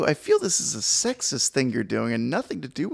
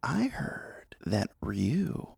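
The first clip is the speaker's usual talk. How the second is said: slow hushed gossip whisper; 6 semitones lower, 11 dB quieter, much slower than usual. Pretend. Friend spilling Ryu gossip in a slow hushed whisper. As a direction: draw the secret out in a whisper.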